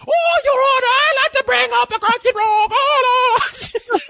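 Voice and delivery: very high, hammed-up courtroom squawk; over-the-top comic barrister in falsetto; mock courtroom lawyer. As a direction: shriek a silly plea to the judge. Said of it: "don't you expect them to say".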